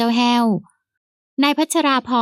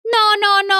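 high, emphatic no no no; servant's mock English; 10 semitones higher than usual. A narrator's reading. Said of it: "เจ้าแห้วพูดฝรั่งบ้าง".

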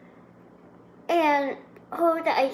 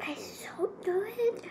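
whispering; 6 semitones higher, 7 dB quieter, slower than usual. Real, not acted. Savoring ice cream, whispered aside.

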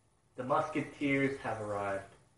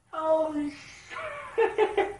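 astonished shout; much slower than usual. Real, not acted. Fan stunned by the bounty, shouting high. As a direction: shriek it in disbelief.

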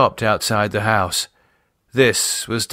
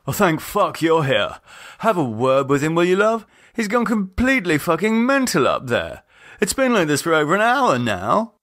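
higher, rough-edged exasperated lad; exasperated Nathan; 8 semitones higher than usual. A narrator's reading. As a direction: plead with her, fed up and rattled.

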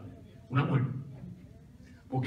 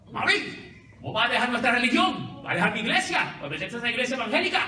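indignant daughter; 6 semitones higher, faster than usual. Retold or imitated. Daughter scolds mother about church.

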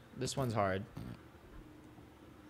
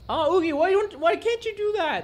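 higher, doting voice to the dog; high pet voice; 8 semitones higher than usual. Real, not acted. Asking dog Oogie why he can't, high doting voice. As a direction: coo at the dog.

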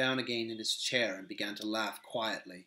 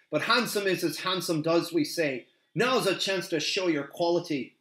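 louder, higher defiant warning; defiant hobbit; 6 semitones higher, 6 dB louder than usual. Acted. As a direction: square up to the captain. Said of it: "with all the courage".

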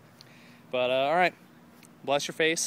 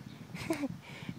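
breathy laugh; 14 semitones higher, 12 dB quieter than usual. Real, not acted. Laughs after his mock shout.

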